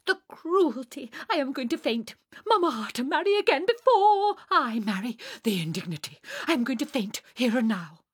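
high, loud hysterical indignation; melodramatic outrage. Acted. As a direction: wail like an insulted diva about to swoon. Sonia outraged, threatens to faint.

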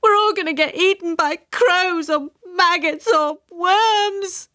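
very high, slow wail; hysterical, high-pitched moaning; despairing wail. A narrator's reading. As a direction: draw out a melodramatic lament. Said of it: "Wailed Jenny suddenly".

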